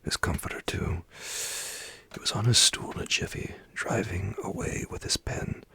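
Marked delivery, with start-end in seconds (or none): none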